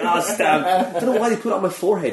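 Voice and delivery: laughing. Retold or imitated. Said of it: "It's like".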